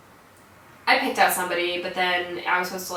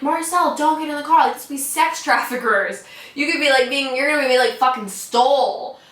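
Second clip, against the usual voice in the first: loud, high retold plea; frantic warning; 6 semitones higher, 5 dB louder than usual. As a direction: re-enact urgently begging a friend to stop. Retelling frantic warning to friend.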